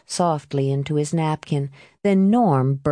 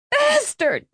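shrieked insult, far higher; scream. Acted. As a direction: scream it as she lunges. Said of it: "Marie screamed".

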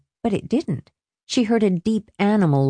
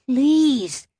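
a mild moaning please, pitched up; 7 semitones higher than usual. A narrator's reading. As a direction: plead with a light moan. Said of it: "he moaned behind his hands".

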